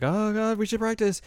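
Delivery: high-pitched, flustered plea; a hammed-up touch of panic; mock nervous. Azusa alone with Moogie getting nervous, flustered. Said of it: "nervous and is like".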